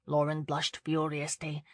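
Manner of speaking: usual speaking voice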